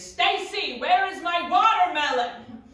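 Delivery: loud, high demanding shout. Retold or imitated. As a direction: yell it like an angry roommate at the door. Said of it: "she's shouting at me".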